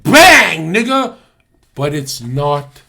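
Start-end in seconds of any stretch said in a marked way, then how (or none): 0.0-1.2 loud, high hype yell; sudden hyped outburst; excited shout. Real, not acted. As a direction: blurt it out like a punchline. Hype exclamation after reading.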